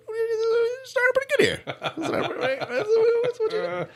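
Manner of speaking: high, squeaky laughing speech; words squeezed out through laughter; laughing while talking. Real, not acted. Host to co-host on the grim start, laughing.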